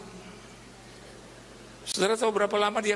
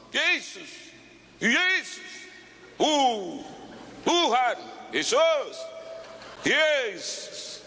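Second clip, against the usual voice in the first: crying out to Jesus; 7 semitones higher, much slower than usual. Retold or imitated. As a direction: call on Jesus in terror. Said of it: "suara saya yang paling besar".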